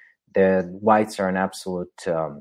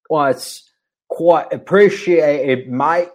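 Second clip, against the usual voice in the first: slow, higher, hammed-up Cockney attempt; bad Cockney accent; 5 semitones higher, 5 dB louder, slower than usual. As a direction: put on a clumsy Cockney accent to thank the donor. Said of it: "Sorry, I cannot do a Cockney accent".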